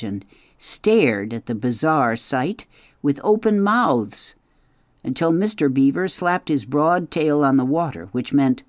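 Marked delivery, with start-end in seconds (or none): none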